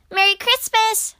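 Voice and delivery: high, loud festive call; cheery shout. A narrator's reading. Squirrel greets Grumpy Badger at his window, shouting. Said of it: "shouted Squirrel".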